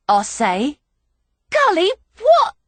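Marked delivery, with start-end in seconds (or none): 1.5-2.5 high, loud startled cry of his name; shocked. Acted. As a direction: yelp it out in shock. Shocked as he grabs her.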